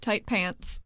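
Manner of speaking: ordinary talk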